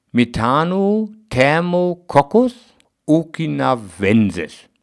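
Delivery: slow, careful spelling-out of a long name; slow motion. Real, not acted. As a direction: take the Latin name slowly, syllable by syllable. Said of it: "da muss ich jetzt ganz langsam sprechen". (ganz langsam gesprochen)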